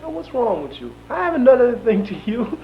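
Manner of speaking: high-pitched mock sheriff, protesting; imitating Sheriff Tyson. Jailed activist retells the sheriff's baffled reply, high-pitched imitation. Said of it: "He said".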